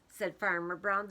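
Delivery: ordinary delivery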